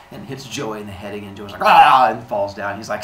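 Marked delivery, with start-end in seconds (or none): 1.6-2.1 loud cartoon cry of pain; hammed-up "ah"; mock pain yell. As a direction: yell as if just clobbered. Joey hit on the head.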